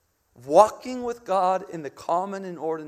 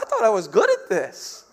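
loud mock-panicked protest; freaking out; 3 semitones higher, 8 dB louder than usual. Preacher retelling his doubt in the shed, acted freak-out. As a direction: replay your own flustered outburst. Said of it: "And I'm freaking out".

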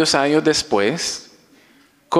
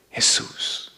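hushed breathy Jesús; reverent whisper; 6 dB quieter than usual. Acted. Preacher whispering the name to the congregation. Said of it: "whispered name".

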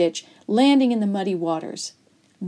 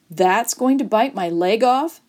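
ordinary delivery

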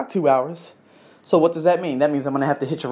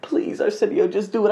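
high-pitched coaxing conscience; little shoulder angel; 5 semitones higher than usual. Acted. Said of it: "little angel on my shoulder was like".

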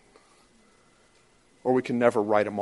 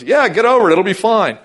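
loud, high, brushing-off shout; dismissive mock shout; 10 semitones higher, 12 dB louder than usual. Strong brother brushing off the weak one, a loud mock dismissal. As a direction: wave them off, loudly and mockingly. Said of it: "the strong brother goes".

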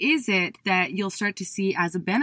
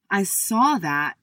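usual speaking voice

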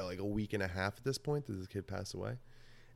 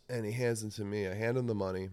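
usual speaking voice

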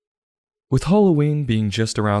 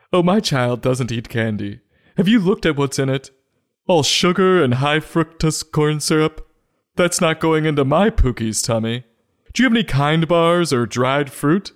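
higher-pitched, fussy health-conscious parent; 5 semitones higher than usual. Acted. Mock parent refusing candy for their child, fussy and higher. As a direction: play a disapproving parent, pitched up.

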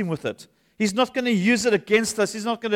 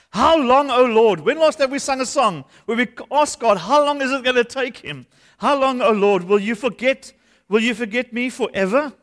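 raised, pleading psalm reading; impassioned scripture reading; 3 semitones higher than usual. Acted. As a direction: read the lament as a cry to God. Pastor reads Psalm thirteen aloud.

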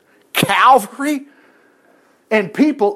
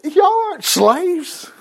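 Joseph reassuring; 9 semitones higher, much slower than usual. Retold or imitated. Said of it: "Joseph's like".

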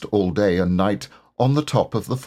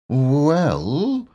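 slowly; 6 semitones higher than usual. A narrator's reading. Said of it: "he said slowly".

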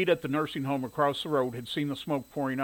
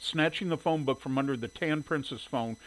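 ordinary delivery, nothing marked

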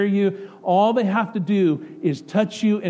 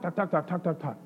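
softer rapid mock chatter; 6 dB quieter than usual. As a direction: poke fun at endless talking. Mocking couples chattering nonstop.

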